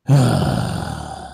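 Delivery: long exasperated exhale; heavy sigh. Real, not acted. Host finishing a rant on Wall Street, heavy sigh. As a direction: let out a big, weary sigh. Sighs after despairing rant.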